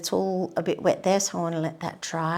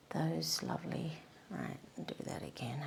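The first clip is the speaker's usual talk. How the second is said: whispering; 2 semitones lower, 12 dB quieter than usual. Real, not acted. Painter talking herself through a tight stroke, whispering. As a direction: murmur it under your breath while concentrating. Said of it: "I don't know why I'm whispering".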